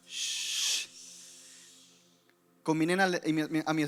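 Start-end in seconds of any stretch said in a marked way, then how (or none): 0.1-0.9 quiet hissed shh; soft shush. Shown as a demonstration. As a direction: shush them gently, barely heard. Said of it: "Otra vez".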